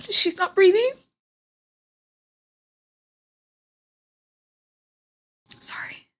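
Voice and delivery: high, tearful voice with long pauses; choked up. Real, not acted. Mother recalling her baby not breathing, tearful and halting. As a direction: fight back real tears while telling it.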